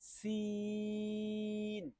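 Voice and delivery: সিন with a long hissing s; Arabic letter sin. Shown as a demonstration. Teacher demonstrating সিন like a bicycle tyre hiss. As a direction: hold the s like air from a tyre. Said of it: "আমি আবারও বলছি".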